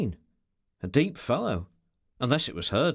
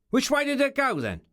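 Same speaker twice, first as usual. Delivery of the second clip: high, raised, impatient question; rough demand; 12 semitones higher, 3 dB louder than usual. A narrator's reading. Ricardo demands directions from Pedro, rough and impatient. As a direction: demand it sharply at a high pitch.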